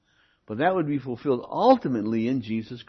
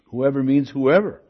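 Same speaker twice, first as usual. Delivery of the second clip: both "Whoever" and "whoever" hit loudly; emphatic WHOEVER; 6 dB louder than usual. Real, not acted. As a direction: punch "whoever" each time it comes. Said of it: "I've emphasized the word whoever".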